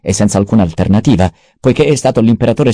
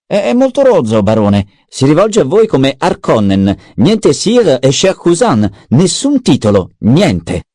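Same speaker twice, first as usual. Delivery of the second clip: much higher, slow, sneering servant's voice; Peter the mentat; 6 semitones higher, slower than usual. A narrator's reading. Mentat Peter reporting to the baron, high and sneering. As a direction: needle the baron in a high mocking tone. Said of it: "Peter si districò dalla sedia".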